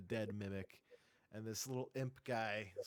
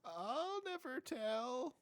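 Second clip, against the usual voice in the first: coy imp voice; 11 semitones higher, much slower than usual. Acted.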